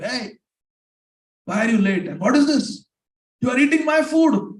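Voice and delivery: high, loud, indignant outburst; angry demon. A narrator's reading. Hungry Rakshas confronts the man eating his food, acted anger. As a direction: storm out roaring at the food thief, acted for a story. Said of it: "He comes out angrily".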